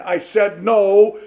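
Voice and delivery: loud, slow final no; each word slow and loud, done arguing; mother had enough. Retold or imitated. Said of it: "she's about had enough".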